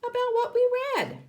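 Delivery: teacher quizzing class on story elements. Real, not acted.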